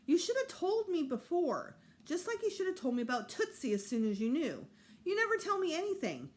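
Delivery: high, loud angry complaint; indignant kid scolding his parents; upset outburst. A narrator's reading. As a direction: let the hurt boil over at Dad. Peter angrily confronts his parents.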